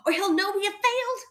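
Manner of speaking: high, hurried worry; fussing. A narrator's reading. Fred hiding failure from Fosdyke, fussing. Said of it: "fussed Fred".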